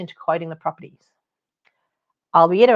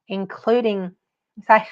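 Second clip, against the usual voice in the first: usual speaking voice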